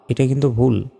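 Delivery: ordinary talk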